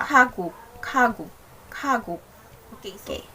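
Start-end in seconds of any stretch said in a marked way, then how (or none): 0.0-2.2 KAGU with airy first k and voiced g in GU, falling; second-syllable G. Shown as a demonstration. Teacher shows the letter becoming G, KAGU with voiced second syllable. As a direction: soften the second consonant of KAGU to g. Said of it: "G sound siya".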